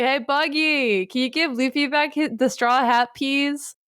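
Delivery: sweetly wheedle, putting on a voice; Shanks asking a favour. Retold or imitated. Shanks asks Buggy for the straw hat back, in a high coaxing voice. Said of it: "sees Buggy and is like".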